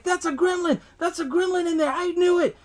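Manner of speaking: put-on high frantic cry of an old man; mock panicked yelling. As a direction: yell it like a terrified neighbour. Old man panics in retold scene.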